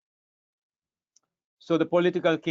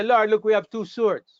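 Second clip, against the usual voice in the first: higher and louder, eager show-and-tell; mock eager disciples; 6 semitones higher, 5 dB louder than usual. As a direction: proudly present the two swords, a bit hammed-up. Disciples eagerly showing swords.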